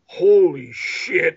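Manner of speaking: slow, stunned mock gasp. Husband stunned by haunting, slow imitated awe. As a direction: let it drag out slowly in disbelief. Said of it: "And he's just kinda like".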